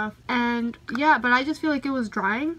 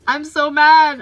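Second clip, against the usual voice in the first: loud mock frustration; 4 semitones higher, 12 dB louder, slower than usual. Real, not acted. Can't buy powder in US.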